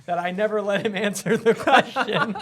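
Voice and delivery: high, helpless laughter through the words; real laughing. Real, not acted. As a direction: laugh while trying to apologize and explain. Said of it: "I'm laughing because".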